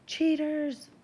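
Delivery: high, loud heckle; drawn-out, high-pitched jeer; accusing shout. A narrator's reading. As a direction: shout it from the crowd. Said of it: "someone shouts".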